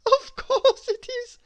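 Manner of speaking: very high, slow, giggle through it; giggling. A narrator's reading. Miss Giggles finds her giggle, giggling. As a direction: laugh breaking through. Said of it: "she giggled".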